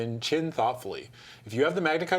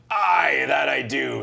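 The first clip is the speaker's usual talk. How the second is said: hearty, louder jovial laugh in the voice; old man jovially answering the traveller, louder; 2 semitones higher, 6 dB louder, much slower than usual. Acted. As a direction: answer like a merry host.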